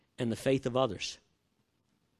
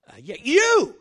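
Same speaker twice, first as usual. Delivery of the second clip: playful shout back; 8 semitones higher, 10 dB louder, slower than usual. Retold or imitated. Answering the dealer playfully.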